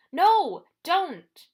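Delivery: high, loud desperate scream. A narrator's reading. Annabeth stopping Percy from looking up, screaming. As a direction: scream to stop him looking. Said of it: "Annabeth screamed".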